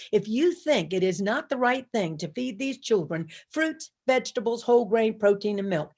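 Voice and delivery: usual speaking voice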